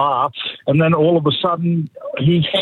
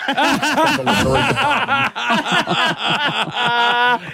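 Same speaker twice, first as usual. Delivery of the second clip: long hearty laugh; 11 semitones higher than usual. Real, not acted. Caller laughs at his prank.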